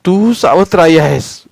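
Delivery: put-on loud, high, furious child rejecting his stepmother; shouting through tears; angry shout. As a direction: hurl the words at her in rage.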